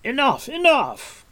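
high, loud, drawn-out call. A narrator's reading. Gwydion stopping Taran shaking the creature, calling out. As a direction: call a halt sharply. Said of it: "Gwydion called".

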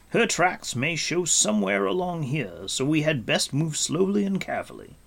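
Gwydion. Acted. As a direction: urge care with firm clarity. Gwydion instructs Taran.

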